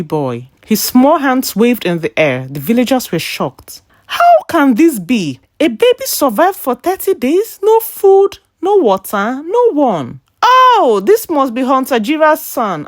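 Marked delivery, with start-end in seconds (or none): 4.1-10.2 higher, rising astonished questions; stunned crowd, pitched up in wonder; shocked disbelief. Acted. Villagers shocked by surviving baby. 10.4-12.8 a lightly voiced old woman calling out; old woman crying out. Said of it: "an old woman cried".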